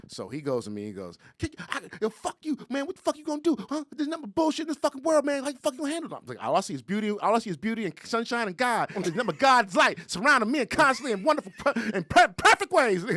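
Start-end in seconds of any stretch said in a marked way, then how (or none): 1.4-6.2 very high, loud cursing rant; frantic mock street tirade; imitated crazy yelling. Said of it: "he started going crazy". 6.4-13.0 high, loud ecstatic raving; hammed-up crazed rapture; out-crazying him. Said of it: "I can out crazy him".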